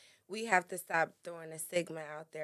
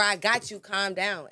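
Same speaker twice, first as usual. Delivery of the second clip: raised, insistent voice; forceful delivery; 2 semitones higher, 6 dB louder than usual. Shown as a demonstration. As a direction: push the reassurance out hard, like an order. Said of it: "It's all about your delivery".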